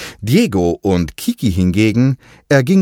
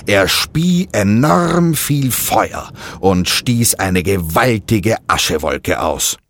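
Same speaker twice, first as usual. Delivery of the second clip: usual speaking voice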